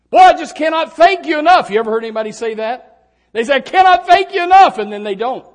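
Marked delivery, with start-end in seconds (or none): 0.1-1.7 loud, high, gushing gratitude; effusive thanks. Acted. People thanking then forgetting, effusive. 3.5-4.8 very loud, high-pitched gushing; effusive thanks. Acted. People who say it and then don't, effusive.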